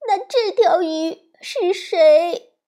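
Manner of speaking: high, slow, choking on sobs; sobbing question. Acted. 小熊妹妹哭着问妈妈，抽抽搭搭. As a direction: ask through tears like a heartbroken little girl. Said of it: "小熊妹妹抽抽搭搭地问".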